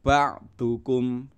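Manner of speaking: Ba'dukum slow with every vowel short; teacher showing short vowels; all short. Shown as a demonstration. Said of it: "Pendek semua".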